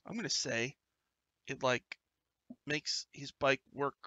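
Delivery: ordinary delivery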